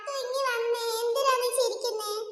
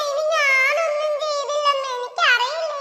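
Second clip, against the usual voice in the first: pleading cry; 4 semitones higher, 7 dB louder than usual. Acted. Son denies blame to angry mother.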